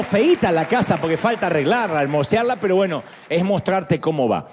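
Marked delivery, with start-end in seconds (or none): none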